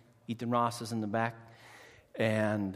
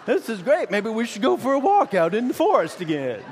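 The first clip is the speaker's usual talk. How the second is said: higher, louder teasing voice with a laugh; cheeky son; 6 semitones higher, 5 dB louder than usual. Retold or imitated. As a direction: needle Mom with mock enthusiasm. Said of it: "takes the opportunity to say".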